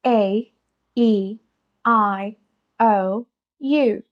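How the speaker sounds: each vowel slowly stretched and separated; over-articulated vowel drill; exaggerated vowels. Shown as a demonstration. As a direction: open the mouth fully on every vowel. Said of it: "इस exaggerated style में बोलने से".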